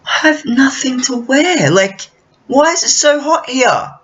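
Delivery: high, whiny mock outrage; panicked sweating friend. Mimicking the American friend sweating in the Australian heat, high and whiny. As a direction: wail it like he's melting. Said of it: "And he was like".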